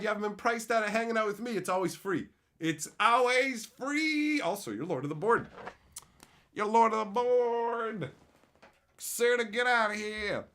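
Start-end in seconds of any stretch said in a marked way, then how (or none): 2.6-4.4 It's always free stretched slow and higher; sing-song, slow and louder; drawn-out higher. Shown as a demonstration. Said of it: "repeated drawn out". 6.5-10.4 slow, louder, higher proclamation of Lord of the Board; booming title announcement; grand announcer. Shown as a demonstration. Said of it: "repeated grandly".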